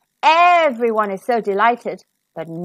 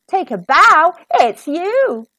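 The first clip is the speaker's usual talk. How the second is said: louder, high joyful call; triumphant discovery; 5 semitones higher, 5 dB louder than usual. Acted. Prince declares Cinderella the one, loud and joyful. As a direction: announce the winner.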